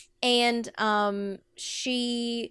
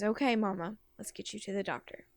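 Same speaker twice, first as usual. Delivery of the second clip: soft, defeated son; hushed resignation; subdued, internalizing; 3 semitones lower, 8 dB quieter than usual. Acted. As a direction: say it quietly, holding the hurt in. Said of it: "And then he internalizes".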